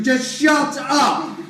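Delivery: loud, slow, furious shout; full angry yell at the servant; shouted order. Acted. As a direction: bark it at him to shut up. Bill collector snaps at servant.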